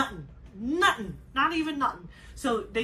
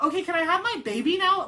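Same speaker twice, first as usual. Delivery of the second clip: impatient plea; 8 semitones higher, 6 dB louder than usual. Retold or imitated. Mother asking for her newborn.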